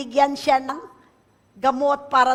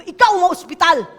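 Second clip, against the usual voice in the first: defiant retort; 5 semitones higher, 10 dB louder, faster than usual. Retold or imitated. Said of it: "Sabi ko".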